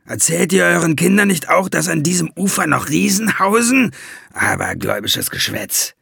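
a hint of a mocking dwarf; dwarf mocks the ferryman's superstitions, lightly scornful; lightly voiced scoffing dwarf. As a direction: brush it off as nonsense. Valiant scoffing.

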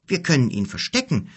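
hopeful attempt. A narrator's reading. Son tries persuading his father, hopeful. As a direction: try to persuade, lightly insistent. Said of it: "versuchte es Ado noch einmal".

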